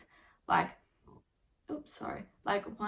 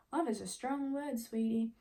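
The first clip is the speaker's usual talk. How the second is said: higher, softer condescending purr; higher mock-sweet brush-off; sarcastic put-down; 4 semitones higher, 5 dB quieter than usual. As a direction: pat him on the head with words. Her sarcastic reply to him.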